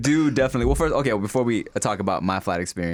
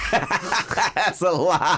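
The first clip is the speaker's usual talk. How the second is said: long drawn-out, higher, laughing; laughing accusation; 6 semitones higher, much slower than usual. Real, not acted. Calling out co-host's claim about first class, laughing accusation. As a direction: stretch the words out through laughter. Repeats objection, laughing and stretched.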